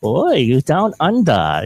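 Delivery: drawn-out, put-on Australian accent; mock Australian accent. Host hailing listeners down under in a mock Australian accent. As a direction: play at being Australian, slowing the first words. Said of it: "Oh, man, Sydney, Australia".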